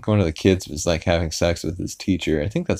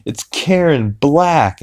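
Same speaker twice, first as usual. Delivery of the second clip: high, thrilled gasp of a name; mock starstruck excitement, slow on the name; excited recognition; 8 semitones higher, 4 dB louder, slower than usual. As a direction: announce her like a star sighting. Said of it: "he's like".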